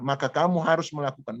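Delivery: usual speaking voice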